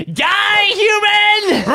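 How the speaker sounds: high shrieking war cry; battle shout. Acted. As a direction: scream it as you charge. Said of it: "Flurbert is like".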